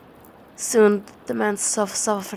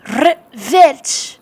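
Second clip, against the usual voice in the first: loud, high, flung-out cry; cry of revenge; 6 semitones higher, 11 dB louder than usual. Acted. Narrator crying out for revenge, loud and high. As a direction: hurl the word at the dark. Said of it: "I cried".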